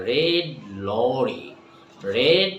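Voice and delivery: ordinary delivery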